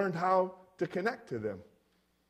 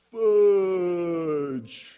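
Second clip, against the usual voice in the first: one long, slow-motion word sliding gently down; drawn-out exclamation; 3 dB louder than usual. Retold or imitated. Boy drops the hubcap and curses, in slow-motion drawl. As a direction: stretch the word out as if time slows.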